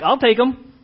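high, loud eager boast; eager David. Retold or imitated. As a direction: jump in eagerly to volunteer. David's eager offer, voiced.

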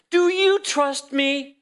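high, slow and drawn out; lofty, unhurried question; God's gentle question. Retold or imitated. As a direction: ask it slowly, lifted up high like a voice from above. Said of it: "the Lord speaking to me".

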